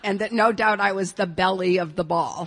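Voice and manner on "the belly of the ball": "belly", like the stomach, in place of belle; mispronounced belle. Shown as a demonstration. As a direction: say "belly" in two syllables for belle. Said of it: "I know how to pronounce those words".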